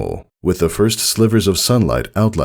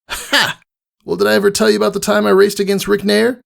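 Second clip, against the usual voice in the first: high, fast, bragging tomboy; launching into a brag story; boastful Rainbow Dash; faster than usual. A narrator's reading.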